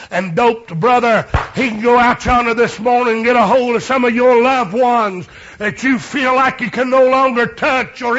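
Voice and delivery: loud, high preacher's shout; full-force preaching. Acted. Preacher to congregation, loud high preaching shout. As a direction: preach it out to the whole church.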